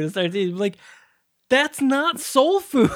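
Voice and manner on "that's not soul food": high, drawn-out objection in disbelief; incredulous protest. Retold or imitated. Co-host objecting to the milkshake line, incredulous. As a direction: stretch each word in exasperated disbelief. Retells his incredulous reaction to scene.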